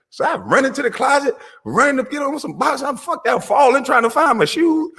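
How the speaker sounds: high-pitched comic panic; frantic retelling. Retold or imitated. Dad retelling running naked for a break-in, high and frantic. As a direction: relive the scramble, voice climbing way up.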